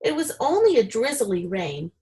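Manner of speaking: ordinary delivery, nothing marked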